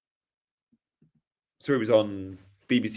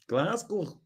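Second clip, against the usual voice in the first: higher, softer Glasgow accent; Scottish accent; 8 semitones higher, 6 dB quieter than usual. Shown as a demonstration. Streamer attempting Glasgow after a Scotland request, Scottish accent. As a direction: say it like a mock Glaswegian. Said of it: "do Scotland".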